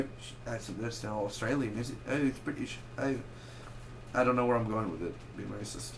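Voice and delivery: ordinary talk, nothing marked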